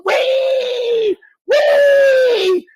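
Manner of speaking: drawn-out high "weee"; mock pig squeal. Taunting a rival to squeal, high pig squeal. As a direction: squeal it high and long, mockingly. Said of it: "Bud, squeal".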